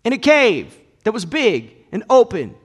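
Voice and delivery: loud, high, driving sermon voice; full-force preaching. Acted. Preacher proclaiming the cave nativity, full force. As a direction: proclaim it to the whole congregation.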